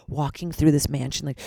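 ordinary delivery

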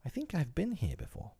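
put-on high-pitched small child, unsure; doubtful. A narrator's reading. Youngest child half-remembering his home, doubtful. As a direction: wonder aloud in a little high voice. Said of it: "looking around him doubtfully".

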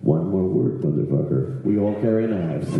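very low, menacing mock threat; seething threat. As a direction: seethe it out in a deep voice. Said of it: "they're just red seething eyes".

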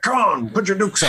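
put-on high, rowdy drunken challenge; drunk king voice. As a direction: slur it like a tipsy brawler. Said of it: "gets drunk and he's like".

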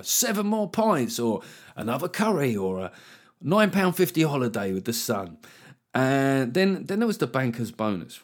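ordinary talk, nothing marked

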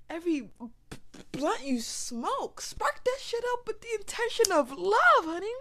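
high, loud, sassy hype; hyped cheer. Real, not acted. Speaker urges listeners to smoke with love, loud sassy hype. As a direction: shout it like a cheeky pep talk.

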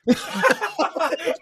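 real high-pitched laughing fit; helpless laughter. Real, not acted. Storyteller cracking up at his own bar story, laughing hard.

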